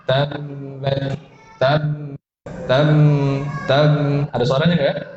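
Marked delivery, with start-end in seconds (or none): 0.1-1.2 "tabbat" as tammm-bat, the sound carried through the lips; wrong flowing b. Shown as a demonstration. Teacher repeats the wrong example for students, flowing b. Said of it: "contoh yang salah". 1.6-3.9 "tab" and "tam" both ending in a long hummed m; wrong flowing sound. Shown as a demonstration. Teacher shows the leaking sound to avoid, flowing m. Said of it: "Ada suara yang mengalir".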